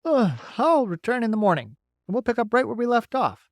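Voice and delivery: bright, folksy beaver. Acted.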